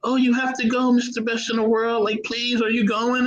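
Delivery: put-on high, pleading kid voice; mock child begging to go; imitating kids. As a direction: beg like an excited little kid. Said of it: "neighbor kids were like".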